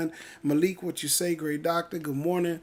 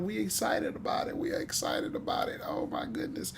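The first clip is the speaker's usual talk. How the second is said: hushed, breathy whisper of excitement; whispered hype, voice nearly gone; excited whisper; 7 dB quieter than usual. Real, not acted. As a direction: drop into a whisper, still thrilled. Hype chant drops to a whisper.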